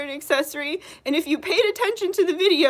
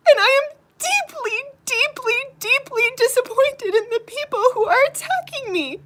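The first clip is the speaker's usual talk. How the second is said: higher, tear-choked voice; crying; 5 semitones higher than usual. Real, not acted. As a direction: fight the sobs as you say it. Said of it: "That's why I'm crying right now".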